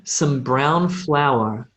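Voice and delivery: very slow, clearly spaced model phrase. Shown as a demonstration. Teacher repeating the phrase slowly for the class. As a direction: stretch each word for learners to copy. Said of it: "Again, one more time".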